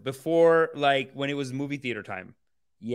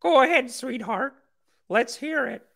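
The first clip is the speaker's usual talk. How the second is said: mocking voice; 11 semitones higher, slower than usual. Mocking the on-screen character.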